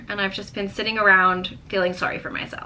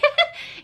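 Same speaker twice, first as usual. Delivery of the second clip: high giggle. Real, not acted. Laughs about binge watching.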